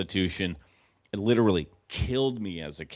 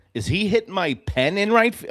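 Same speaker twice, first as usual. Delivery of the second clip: higher and louder, disbelieving outburst; exasperated yell; 10 semitones higher, 6 dB louder than usual. Real, not acted. Fan yelling at his own right fielder in disbelief.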